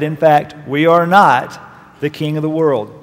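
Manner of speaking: ordinary talk, nothing marked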